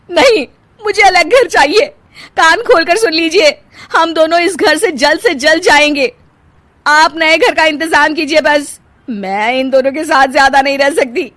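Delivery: loud, high, insistent; demanding. Acted. Wife demanding separate home.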